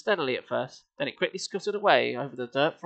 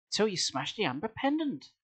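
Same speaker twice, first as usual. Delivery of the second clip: whispering; 5 semitones higher, 6 dB quieter than usual. Acted. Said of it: "Sari whispered".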